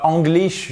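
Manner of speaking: English begun with a French nasal "an", high and rising; wrong English. Shown as a demonstration. Teacher warning learners, wrong French-style English. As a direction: say "English" slowly as "Anglish". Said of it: "c'est pas English".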